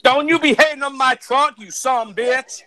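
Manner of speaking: loud, hammed-up country American; bad American accent. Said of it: "That is the worst American accent ever".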